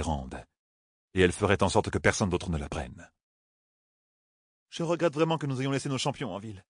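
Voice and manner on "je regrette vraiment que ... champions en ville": voix plus aiguë, soupirée, plus douce; sœur jaune soupirante. A narrator's reading. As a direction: soupire ton regret. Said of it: "soupira la mince sœur jaune". (la sœur jaune regrette ses champions en soupirant)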